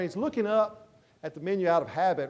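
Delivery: ordinary talk, nothing marked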